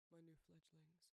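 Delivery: ordinary talk, nothing marked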